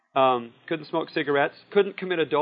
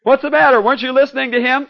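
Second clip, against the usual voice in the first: high, loud mock yell of an angry crowd; retold yelling; 10 semitones higher, 10 dB louder than usual. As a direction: throw it back like the heckling crowd. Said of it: "started yelling at me".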